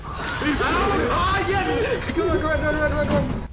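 high excited cheer, go on go on. Real, not acted. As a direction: urge yourself on, thrilled. Excited at game success.

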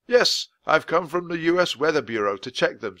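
a hint of a proper old-fashioned gentleman; polite official explaining; lightly voiced weather man explains the castle to children. Voice of Mister Perkins.

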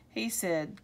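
ordinary delivery

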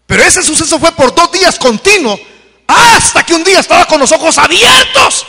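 high, loud climb into a shout; building preacher's shout. Real, not acted. Preacher telling the church of the waking vision, building to a shout.

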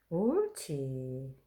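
long slow coaxing praise; drawn-out praise. Real, not acted. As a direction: stretch it out gently, praising the dog. Coaxing the dog to play.

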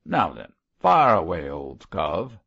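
loud, offhand order; sneering command. A narrator's reading. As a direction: wave him on with a jeer. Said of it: "sneered Mosk".

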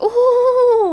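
high, loud squeal of surprise; shocked "ooh". Retold or imitated. Thomas the engine in the picture, high loud squeal. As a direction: let out a big startled ooh. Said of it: "And Thomas like".

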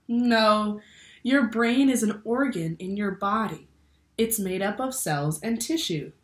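ordinary delivery

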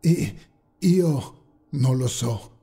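slow, halting stammer; balbettio scioccato. A narrator's reading. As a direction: stumble on the first word, in shock. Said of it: "balbettò Enrico". (balbettando, lento ed esitante)

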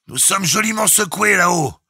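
ordinary delivery, nothing marked

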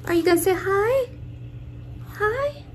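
very high sing-song coaxing. Real, not acted. Coaxing baby to greet viewers.

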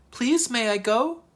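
high, slow plea; pleading child. A narrator's reading. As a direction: ask permission pleadingly, drawing it out. Child asks parents for permission.

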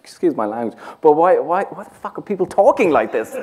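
high, incredulous outburst; outraged disbelief at the accent; baffled exclaim. Retold or imitated. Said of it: "and I went".

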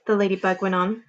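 usual speaking voice

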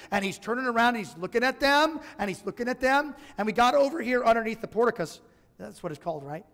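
usual speaking voice

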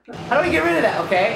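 ordinary delivery

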